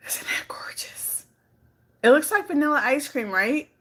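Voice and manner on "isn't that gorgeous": slow, hushed whisper of admiration; awed whisper. Real, not acted. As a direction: whisper it slowly, marvelling. Admires her dessert to camera.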